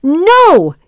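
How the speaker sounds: yelling pigs. A narrator's reading. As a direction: shout it back at the wolf. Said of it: "yelled the pigs".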